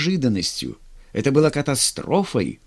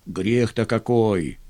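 low, slow heavy sigh; 4 semitones lower, slower than usual. A narrator's reading. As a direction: sigh it out with regret. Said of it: "вздохнул урядник".

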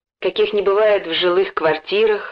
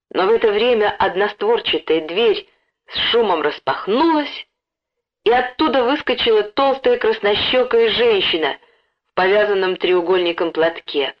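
plain reading voice

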